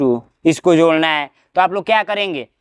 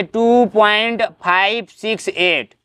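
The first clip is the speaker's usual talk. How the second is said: slow count through "five six एट"; teacherly slow reading of "five six एट"; digit by digit; much slower than usual. Shown as a demonstration. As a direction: read "five", "six", "एट" as separate digits, unhurried. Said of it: "एक एक digit पढ़ते हैं".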